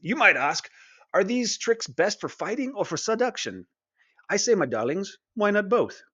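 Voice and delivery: reading the quote as a mild impression of Asterion's accent, playfully seductive. Reviewer reading Asterion's quote to viewers in a lightly put-on Asterion accent. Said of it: "I'm gonna try to do the accent".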